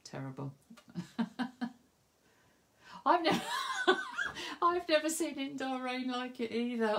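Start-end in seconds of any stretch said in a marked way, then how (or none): 3.1-6.9 breaking into laughter mid-sentence; laughing. Real, not acted. Recalling the flooded hotel bar, laughing hard. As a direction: laugh through the words, high and giddy.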